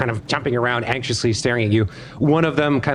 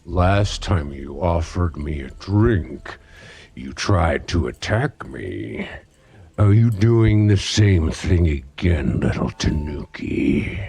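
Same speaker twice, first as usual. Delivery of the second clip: lower, slow smirking threat; lower, slow gremlin boss taunting Tanuki; menacing boss gremlin; 6 semitones lower, slower than usual. Acted. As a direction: drawl it low and slow like a smug monster.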